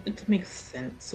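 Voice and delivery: usual speaking voice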